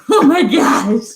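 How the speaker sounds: loud laughing shout; exasperated outburst. Retold or imitated. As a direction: throw it out in mock exasperation. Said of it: "Ed is like".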